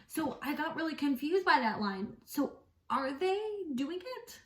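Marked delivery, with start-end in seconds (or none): 2.2-4.4 incredulous. Real, not acted. Reader baffled by novel line.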